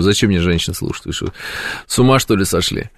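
ordinary talk

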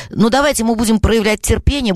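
usual speaking voice

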